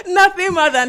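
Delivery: high squealing laugh through words. Real, not acted. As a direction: laugh while saying it. Laughing at own fantasy.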